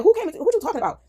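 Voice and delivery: high-pitched, disbelieving retort; retold incredulous questioning. Questioning housemate about the bar fight, acted disbelief. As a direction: act out challenging a story, voice jumping up. Retells challenging a bar story.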